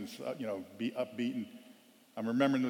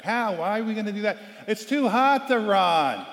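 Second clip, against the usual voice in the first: higher, slowed laughing whine; whining player; 7 semitones higher, 6 dB louder than usual. Retold or imitated. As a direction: moan about the heat. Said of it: "complaining and whining".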